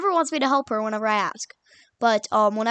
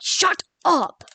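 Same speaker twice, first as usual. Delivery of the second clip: higher-pitched mock yell; angry snap; 6 semitones higher, 4 dB louder than usual. Classmate whenever she tried to talk to her, mock angry snap. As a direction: imitate a classmate cutting you off.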